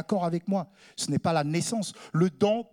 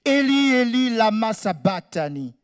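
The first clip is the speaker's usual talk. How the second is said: held, raised cry that falls away; loud cry; 2 semitones higher than usual. Acted. Preacher voicing Jesus on the cross, crying out. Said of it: "Jésus s'écria d'une voix forte".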